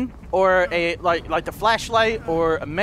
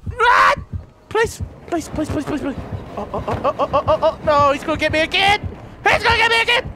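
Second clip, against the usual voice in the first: panicked yelling; 7 semitones higher than usual. Real, not acted. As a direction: yell in a frenzied panic. Chase panic in game.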